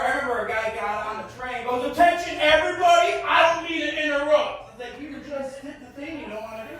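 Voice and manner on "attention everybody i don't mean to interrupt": loud, raised call to the whole car; subway announcement yell. Retold or imitated. Stranger addressing a full subway car, loud call. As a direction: shout over the train noise. Guy yelling on subway train.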